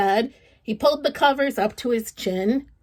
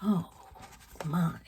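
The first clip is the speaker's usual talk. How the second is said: put-on soft whisper to the children; storyteller whispering to young listeners; hushed aside; 5 semitones lower, 10 dB quieter than usual. A narrator's reading. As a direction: lean in and whisper the question.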